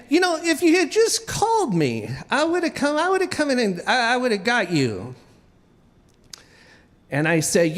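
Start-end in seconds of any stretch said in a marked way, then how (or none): 0.1-5.1 higher mock scolding wife. Wife scolding, voiced by husband.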